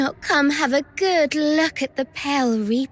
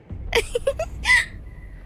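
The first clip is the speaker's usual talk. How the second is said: high wailing fake cry with laughter; mock sobbing laugh; 3 dB quieter than usual. Traitor mocks the captain with fake crying. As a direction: ham up fake tears while laughing at him.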